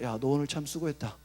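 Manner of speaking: low, soft, kindly pat on his own back; quiet tender self-encouragement; self-praise. Retold or imitated. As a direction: praise yourself gently. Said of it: "제가 저한테 뭐라 하죠?".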